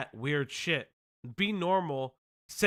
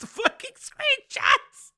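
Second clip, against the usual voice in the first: laughing disbelief; 7 dB louder, much slower than usual. Real, not acted.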